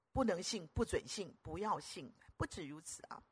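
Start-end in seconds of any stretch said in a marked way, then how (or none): none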